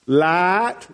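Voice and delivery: "light" in Southern, long flat vowel like "laht", rising; Southern accent. Shown as a demonstration. Preacher demonstrating his Southern lesson for an English friend, Southern accent. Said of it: "teach him to speak Southern".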